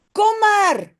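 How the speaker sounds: high, loud call across the field. Acted. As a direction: shout to coax her back. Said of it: "schreeuwt Jip". (appel crié, aigu et fort)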